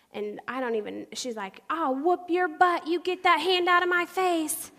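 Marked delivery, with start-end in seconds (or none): 1.7-4.7 high, loud, slow-stretched threat; mock furious yell, drawn out; threatening mom. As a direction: imitate an irate mother squaring up.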